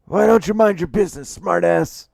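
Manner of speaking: high, loud, indignant client voice; angry retort. Acted. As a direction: lash out at the meddler. Insulted client snaps back.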